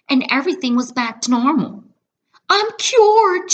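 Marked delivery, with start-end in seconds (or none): 2.5-3.5 high, loud happy shout; joyful shout. A narrator's reading. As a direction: shout it with joy. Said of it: "she shouted".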